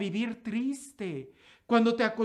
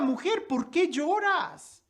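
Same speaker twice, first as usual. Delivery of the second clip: Jesus asking; 7 semitones higher, 3 dB louder, slower than usual. Acted. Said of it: "Jesús se le aparece y le pregunta".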